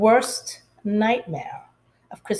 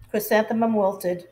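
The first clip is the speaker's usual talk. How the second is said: usual speaking voice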